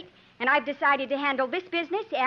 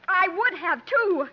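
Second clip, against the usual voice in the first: higher and louder, defiant retort; 5 semitones higher, 3 dB louder, slower than usual. Acted. Sandal tells Jim she'd have killed him, defiant. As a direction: throw it back at him.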